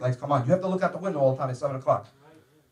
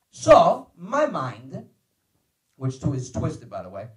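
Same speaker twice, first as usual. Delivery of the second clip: ordinary delivery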